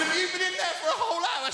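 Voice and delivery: high, fast astonished question; shocked disbelief. Retold or imitated. Teacher finding his pupil in the cupboard, astonished. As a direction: gasp it out in disbelief.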